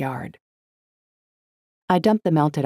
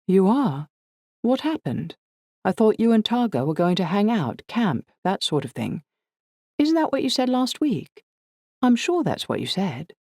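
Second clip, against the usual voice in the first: Liz surprised; 7 semitones higher than usual. A narrator's reading. Liz voiced, surprised questioning.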